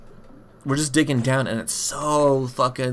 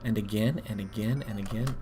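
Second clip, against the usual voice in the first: ordinary delivery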